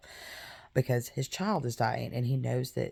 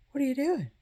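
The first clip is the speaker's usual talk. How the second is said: high-pitched incredulous servants' voice; pitched-up servant asking what he's doing; servants' baffled question; 12 semitones higher, much faster than usual. Retold or imitated. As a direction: ask it high, as a confused servant. Servants question David's calm.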